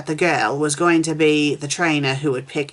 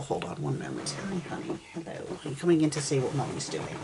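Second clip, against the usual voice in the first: hushed aside; 9 dB quieter than usual. Real, not acted. Reading interrupted by her child.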